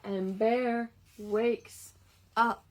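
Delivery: lively storybook read-aloud; reading to children. A narrator's reading. Reading a picture book to children, lively storytime voice. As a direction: read it like story time for little kids.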